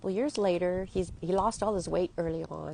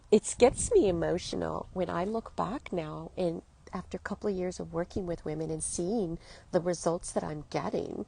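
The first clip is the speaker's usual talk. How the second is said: ordinary talk, nothing marked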